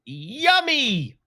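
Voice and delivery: high swooping sing-song title; hammed-up title announcement. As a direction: announce it with a big silly swoop. Announcing silly film title theatrically.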